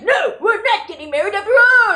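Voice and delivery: mock loud protest. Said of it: "people are like".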